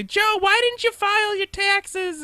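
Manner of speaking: high, loud, slow nagging mother; put-on shrieky mother voice; mom voice. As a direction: caricature a scolding mom, drawn out. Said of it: "I use my mom voice".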